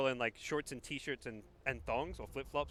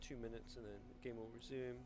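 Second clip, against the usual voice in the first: a lightly put-on captain over the intercom; airline pilot voice; 7 dB quieter than usual. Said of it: "Is that your airline pilot voice?".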